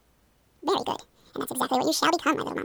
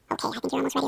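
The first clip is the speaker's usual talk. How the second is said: ordinary talk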